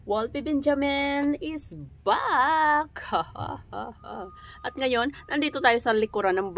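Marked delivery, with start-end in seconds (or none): 0.1-3.0 high, very slow hammed-up announcer; showy announcement. Vlogger announcing her own return, hammed-up host voice. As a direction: proclaim the comeback like a host. Grand redo of vlog intro.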